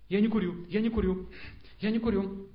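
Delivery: insistent repeated self-denial; stubborn self-talk. Acted. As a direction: drum it into yourself. Acting rajas struggle against smoking.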